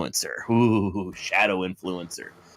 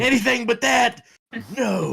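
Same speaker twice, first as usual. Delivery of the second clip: mock horror; 12 semitones higher than usual. Mock despair over the joke.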